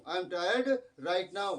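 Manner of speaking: very slow, even; slow dictation. Retold or imitated. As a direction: read slowly to students. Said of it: "वैसे बोला कि".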